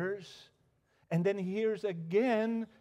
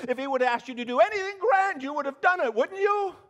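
faster and louder, climbing to a high peak; building preacher's challenge; 9 semitones higher, 8 dB louder, faster than usual. Real, not acted. Preacher presses the congregation, louder and higher. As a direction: drive the question home, pushing higher.

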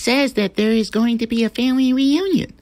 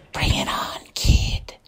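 slow whispered stick-up threat; menacing whispered villain voice; 4 dB quieter, slower than usual. Acted. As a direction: whisper it like a sneaky snake robber. Serpent taunts Bowshot.

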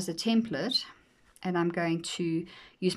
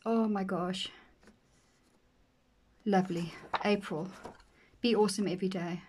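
ordinary delivery

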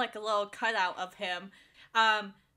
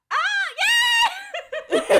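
mock cheer; 10 dB louder than usual. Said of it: "he's like".

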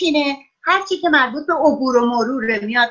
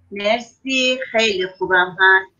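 ordinary delivery

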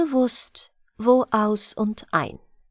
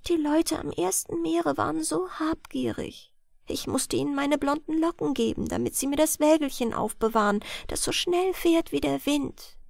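put-on higher, softer young girl telling her sorrow; lightly sad girl explaining; soft girl's voice; 5 semitones higher, 5 dB quieter than usual. A narrator's reading. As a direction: speak small and hushed like the girl. Girl's voice in story.

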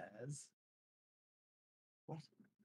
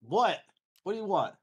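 put-on irritated, louder snap at the kid; fed-up parent shouting back; annoyed dad; 6 semitones higher, 6 dB louder than usual. Snapping at daughter in retelling.

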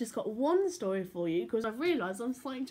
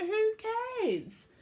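high, loud careless scoff; high sneering who-cares; dismissive; 13 semitones higher, 4 dB louder than usual. Acted. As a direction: brush it off like a lazy pirate. Lazy pirate dismissing.

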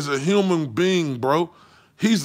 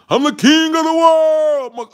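mock grandiose shout, higher and louder, slow; mocking boaster; 7 semitones higher, 3 dB louder than usual. Vlogger mocking Wilder as arrogant, with a slow, loud, higher mock proclamation. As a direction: ham up a pompous man declaring himself king. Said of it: "Leonardo DiCaprio looking ass".

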